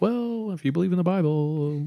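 sing-song pointed comeback to a doubter; mock retort. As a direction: raise the pitch as if stating the obvious. Mimicking a retort to doubting Christians.

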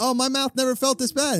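infomercial voice. Retold or imitated. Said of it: "before commercials for those teeth places".

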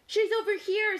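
high, loud announcement; urgent high storybook shout; calling out. A narrator's reading. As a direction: call it across to the others. Cow calls out finding a piece.

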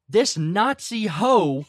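imitated outburst, loud and high, drawn out at first; mock angry rant. Retelling a red-faced customer's rant to friends, mock outrage. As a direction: rant it loudly as the fed-up customer, playing for laughs.

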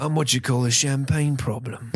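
put-on English accent; Jason Statham. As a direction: play an English tough guy. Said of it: "Jason Statham".

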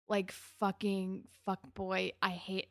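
ordinary delivery

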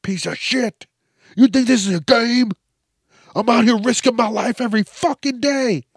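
high, loud raging officer; furious cop. Pretend. Cop berating the star while hitting him, high loud rage. Cop pistol-whipping in skit.

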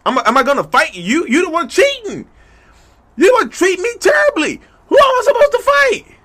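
building from indignant to high, loud crying shout; outraged tearful shout. Acted. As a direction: rage at a cheating partner until the voice cracks. Acted quarrel, rising to tearful shout.

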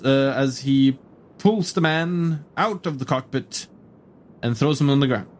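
normal talking voice

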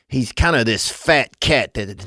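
normal talking voice

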